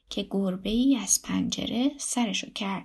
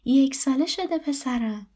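higher, louder delighted answer; happy; 4 semitones higher, 5 dB louder, slower than usual. A narrator's reading. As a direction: answer brightly like a proud mother. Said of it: "ریحان خاتون با خوشحالی گفت".

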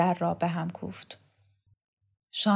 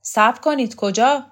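loud, higher-pitched call to halt; raised voice, urgent challenge; shouted call; 5 semitones higher, 7 dB louder than usual. A narrator's reading. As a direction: call out sharply to stop them. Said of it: "مؤید داد زد".

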